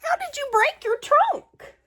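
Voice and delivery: high, excited pig voice; Piggy voice. Acted. Piggy asks Gerald about his trunk, high piggy voice. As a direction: squeal it out as Piggy.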